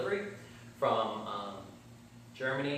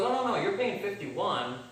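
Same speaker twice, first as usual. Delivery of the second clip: lightly voiced salesman, higher and slow; smug salesman; 7 semitones higher than usual. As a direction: play the salesman breaking the news slowly. Salesman springs the higher price.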